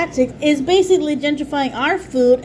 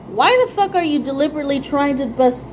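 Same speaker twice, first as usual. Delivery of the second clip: normal talking voice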